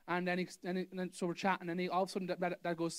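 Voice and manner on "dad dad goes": usual speaking voice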